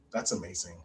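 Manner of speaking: hushed awe. Retold or imitated. Aunt moved by the recording, hushed and low. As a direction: breathe it out in awe. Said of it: "I'm like".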